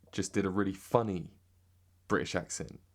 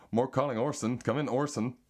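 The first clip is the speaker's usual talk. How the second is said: put-on raised-pitch American accent; 4 semitones higher than usual. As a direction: chirp the catchphrase in American. Repeats the American catchphrase.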